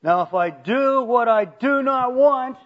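"do not want" high and loud; emphatic. Acted. Preacher reading Paul's emphatic words, stressed. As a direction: drive home "do not want". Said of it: "it's emphatic again right there".

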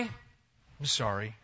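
very slow, low, sulky sorry; a forced, grudging apology. Retold or imitated. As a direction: drag the word out unwillingly. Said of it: "If you want me to say I'm sorry".

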